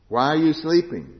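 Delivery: Jesus scolding the sleeping disciples, loudly; loud rebuke. Acted. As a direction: call them out for sleeping.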